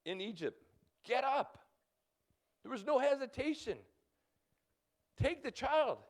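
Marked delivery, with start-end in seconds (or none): 1.0-1.6 angel's command. Acted. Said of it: "the angel of the Lord appeared to Joseph". 5.2-6.0 angel's command. Acted. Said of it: "the angel of the Lord appeared to Joseph".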